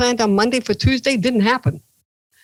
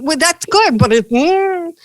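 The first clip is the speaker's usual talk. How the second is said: awkward high reply; 8 semitones higher, 4 dB louder, slower than usual. Retold or imitated. Reacting to Bhutto's coffee.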